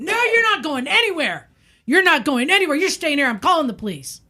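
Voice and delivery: high, furious imitated threat; mock screaming. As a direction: refuse to let them go, yelling. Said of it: "he's just screaming".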